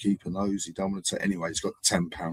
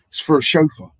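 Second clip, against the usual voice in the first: mock complaint; 7 semitones higher, 9 dB louder than usual. Rolls owner complaining.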